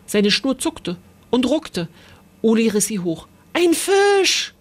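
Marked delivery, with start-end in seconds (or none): 3.5-4.5 much higher, storybook roar; high-pitched excited shout; excited bellow. A narrator's reading. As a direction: yell out the big discovery. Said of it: "brüllte Aki".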